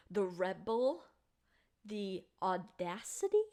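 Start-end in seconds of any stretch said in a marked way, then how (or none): none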